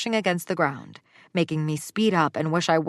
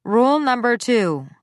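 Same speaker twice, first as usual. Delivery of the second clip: loud, stern announcement; dictating firmly; 6 semitones higher, 6 dB louder than usual. Acted. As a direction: bark out the next rule. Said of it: "I dictated with a hand".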